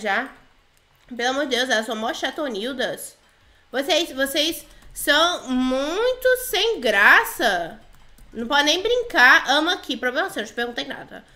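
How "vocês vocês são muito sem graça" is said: "vocês são muito sem graça" drawn out word by word; slow dictation. Pretend. Player types her complaint "vocês são muito sem graça", slowly dictating. Escreve reclamação, devagar.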